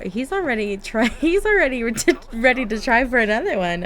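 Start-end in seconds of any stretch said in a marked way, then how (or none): none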